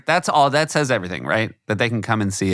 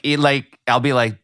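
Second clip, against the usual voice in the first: usual speaking voice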